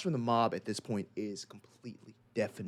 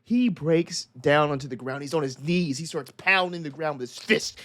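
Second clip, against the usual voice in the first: ordinary talk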